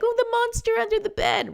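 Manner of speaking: high-pitched mock-scared child; frightened kid. Kids at bedtime fearing a monster, mock fear. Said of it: "when kids go to bed at night".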